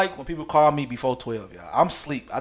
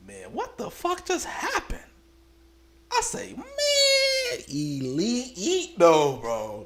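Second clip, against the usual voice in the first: stunned disbelief; 11 semitones higher, slower than usual. Retold or imitated. Stunned self-talk after night.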